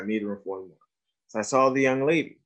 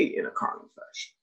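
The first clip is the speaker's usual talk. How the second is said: hushed aside; 5 semitones lower, 10 dB quieter than usual. Retold or imitated. To the audience, admitting the girl never said it: a hushed, voiceless aside. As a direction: repeat the phrase under the breath, voiceless and much softer.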